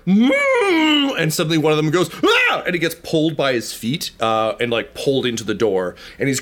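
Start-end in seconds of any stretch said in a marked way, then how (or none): 0.1-1.2 mm. Acted. Creature sound behind the door. 2.2-2.6 a very high, loud shriek of shock; sudden scream. Acted. Farmer grabbed by the feet, letting out a shrill scream. Farmer yanked through the door.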